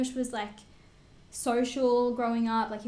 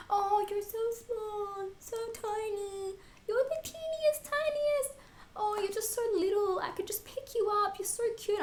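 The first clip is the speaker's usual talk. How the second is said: cooing stranger; 11 semitones higher than usual. Retold or imitated. Mocking fans who gush about her size, high cooing. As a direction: coo like someone fawning over a puppy. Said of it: "someone always says".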